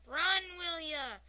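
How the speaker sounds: loud, high, slow Scottish shout; thick Scottish accent roar. A narrator's reading. Scotsman standing up at the game, Scottish accented roar. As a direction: stretch it out in a Scottish holler. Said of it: "roars with the crowd in his thick accent".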